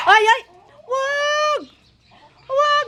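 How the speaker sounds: high sharp cry to stop the dogs; alarmed shout. Real, not acted. Stopping dogs from fighting. (pasigaw na pagsaway sa aso)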